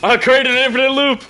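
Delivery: high, loud delighted outburst; gleeful yell, pitched right up; excited shout. Real, not acted. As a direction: shout it out high, thrilled at the mishap. Delighted by accidental game loop.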